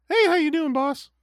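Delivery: very high, loud squeaky cartoon voice; Mickey Mouse voice. Cheery mouse voice greeting the boss at work.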